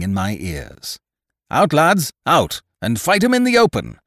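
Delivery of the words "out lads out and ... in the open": loud, high shouted command; rally the men with a cry; cried out orders. A narrator's reading. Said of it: "cried the captain".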